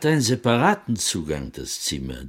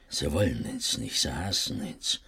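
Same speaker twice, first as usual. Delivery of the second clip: fearful hushed warning; 9 semitones lower, 10 dB quieter than usual. Acted. Father warns son of hatred.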